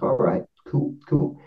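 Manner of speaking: hushed, breathless "cool, cool"; whispered aside. Retold or imitated. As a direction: whisper it as if the spirit might hear. Reacting quietly to spirit's name.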